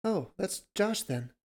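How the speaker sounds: lightly voiced relief; relieved. A narrator's reading. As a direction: let the worry go as you recognise him. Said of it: "she said, relieved".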